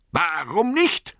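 high, drawn-out angry cry; Bilbo, zornig. A narrator's reading. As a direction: call it out indignantly, slow and high. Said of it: "rief er". (zorniger Ausruf, hohe Stimme)

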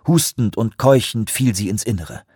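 ordinary delivery

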